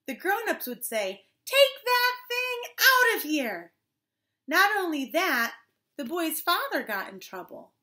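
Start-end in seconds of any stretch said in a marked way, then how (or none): 1.4-3.7 high, drawn-out scolding yell; annoyed shout. Acted. Grown-ups to the boy with the ukulele, annoyed shout. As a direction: shoo them off, loud and slow.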